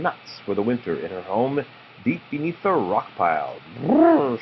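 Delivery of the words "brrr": high shivery brrr from the cold; shivering brrr. Acted. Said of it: "she said".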